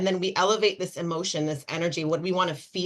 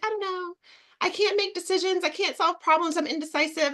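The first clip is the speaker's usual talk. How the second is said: much higher, helpless whine; whiny client; 12 semitones higher than usual. Retold or imitated. Imitating the indecisive client's high helpless whine. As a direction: play the client who can't decide. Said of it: "She's like".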